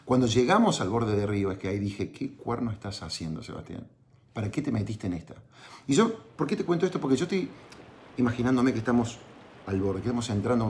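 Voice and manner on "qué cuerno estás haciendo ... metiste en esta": quiet self-reproach. Retold or imitated. Inner self-reproach at the riverbank.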